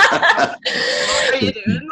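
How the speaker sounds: slow, hammed-up mock-Irish accent. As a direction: drag out a teasing parody of Irish speech. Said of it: "We don't sound like that".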